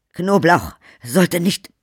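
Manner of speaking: high, slow startled cry; pained outcry. Acted. Count cries out after garlic burns his hand. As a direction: gasp it out after the burn. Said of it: "brachte er hervor".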